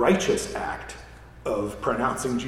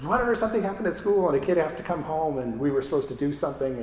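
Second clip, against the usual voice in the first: higher-pitched, rushed mimicry of a wife; imitating wife's phone call; 6 semitones higher, faster than usual. Wife calling husband at work about the kid, imitated in a rush. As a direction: rattle off a mock domestic report in a raised pitch. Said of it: "Carmen calls and says".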